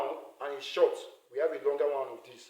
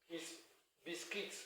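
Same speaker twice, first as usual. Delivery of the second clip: ordinary delivery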